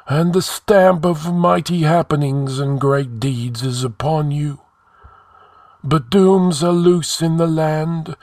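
high, sustained ghostly intoning; prophesy as an ancient sage from the tomb; solemn spirit voice, held high; sepulchral. A narrator's reading. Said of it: "spoke in sepulchral tones".

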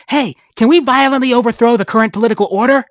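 high, fast and eager question; eager kid. Acted. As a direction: rattle it off like an excited kid. Corpse eagerly asks Ant King.

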